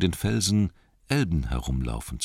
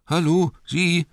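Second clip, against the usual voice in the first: high, loud, drawn-out shaky call; quavering call; 13 semitones higher, 6 dB louder than usual. A narrator's reading. Injured Bilbo hailing a man on the slope, quavering call. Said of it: "rief er mit zittriger Stimme".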